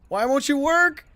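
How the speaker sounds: high frustrated yell; pleading with a machine. Real, not acted. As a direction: cry out at the unresponsive game. Yelling at broken game controls.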